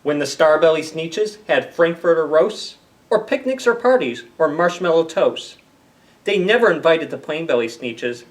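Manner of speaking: lively picture-book narration; storybook reading. Acted. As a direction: play up the rhyme for the kids. Reads Dr. Seuss aloud to kids.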